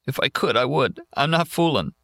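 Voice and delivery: tearful. Acted. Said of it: "a man sitting against that big tree crying".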